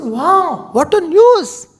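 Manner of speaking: high, loud joyful exclaim; delighted. Acted. Kekai hears of Ram's coronation, high delighted cry. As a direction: burst out in delight.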